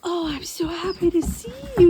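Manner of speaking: high cooing at normal pace. Real, not acted. Cooing to the puppy.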